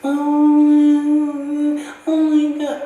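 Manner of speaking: high, loud cooing gush; gushing over baby. Retold or imitated. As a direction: gush like a doting parent. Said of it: "everybody sit there and".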